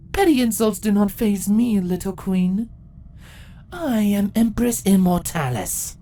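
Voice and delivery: proud empress telling her victory; ancient empress. Acted. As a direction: gloat over the long wait. Empress gloating.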